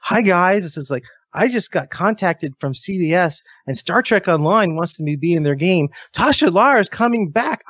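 high, bubbly imitation of an excited actress; gushing excitement. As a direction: gush the news like a thrilled fan. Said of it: "The first thing she says is like".

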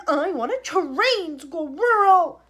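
high, loud, very slow whine; spoiled demand. Acted. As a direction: drag out the demand. Veruca's demand.

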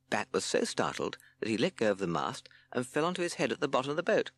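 usual speaking voice

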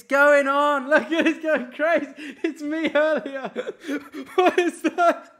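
very high, loud squeals breaking into laughter; shrieking with laughter. Real, not acted. Streamer shrieking with laughter at his own clip on the stream. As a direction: lose it completely, laughing and squealing at the screen. Chat goes wild over camera.

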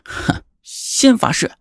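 high, slow scornful sneer; cold sneer. Acted. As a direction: scoff at them. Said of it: "方继帆冷笑". (冷笑着说)

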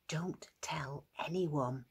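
slow, very soft whisper. Acted. Jesus warning the healed man to keep quiet, whispered. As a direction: whisper it slowly as a secret. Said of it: "whispered Jesus".

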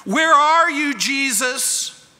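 high, loud cry of distress. Acted. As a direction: call out desperately to Jesus. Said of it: "Have you ever cried out".

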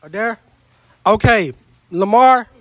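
ordinary talk, nothing marked

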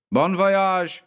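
high, ringing farewell call. A narrator's reading. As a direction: call it out to someone moving away. Calling to the departing ship.

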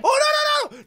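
high, loud burst of alarm; alarmed cry. Real, not acted. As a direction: cry out in sudden panic. Alarm during a game fight.

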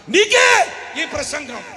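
loud, high, drawn-out first word; emphatic rebuke. Real, not acted. Preacher telling a listener this sermon is for them, emphatic rebuke. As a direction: hurl it straight at the listener.

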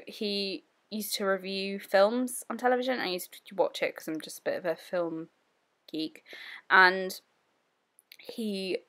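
ordinary talk, nothing marked

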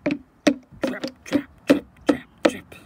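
loud, heavy trip-trap; booming, percussive footsteps; big goat's stomps. Acted. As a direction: pound each word out like big hooves. Biggest goat stomps across bridge.

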